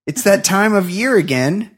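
mock-serious op-ed delivery; scripted comic read. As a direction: read it like a pompous editorial. Reads scripted comic rant.

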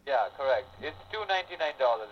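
ordinary talk

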